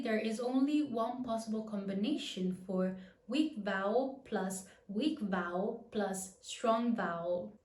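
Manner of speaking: ordinary talk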